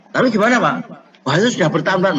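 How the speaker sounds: a worried father begging for help; desperate plea. Retold or imitated. Father begs the clinician for a fix, loud pleading.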